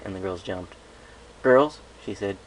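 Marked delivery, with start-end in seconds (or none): 1.4-1.8 loud, sharp rising call of the name; a sharp call to attention; stern call. Acted. Applejack calls the fillies to order, loud and sharp. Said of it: "cleared her throat loudly".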